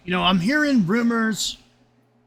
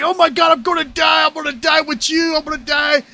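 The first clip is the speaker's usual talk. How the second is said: panicked yelling; 7 semitones higher than usual. Retold or imitated. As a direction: yell it like the plane is going down. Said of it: "I'm like".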